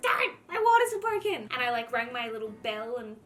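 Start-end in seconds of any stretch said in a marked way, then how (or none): 0.0-1.5 high, loud thrilled call to Darren; excited announcement. Retold or imitated. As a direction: tell him the big news, bursting. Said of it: "I'm like".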